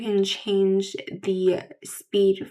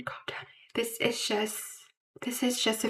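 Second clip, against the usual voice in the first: whispered to herself to calm down; barely voiced self-soothing whisper; 9 dB quieter than usual. Real, not acted. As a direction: hush it down to a whisper, soothing yourself. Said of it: "calm down, [name]".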